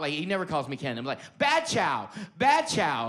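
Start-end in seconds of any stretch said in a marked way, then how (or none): 1.4-3.1 high, loud mock scolding; loud imitation of reprimanding a dog; yelled dog scolding. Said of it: "like a dog".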